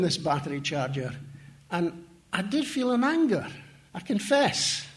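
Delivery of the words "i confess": usual speaking voice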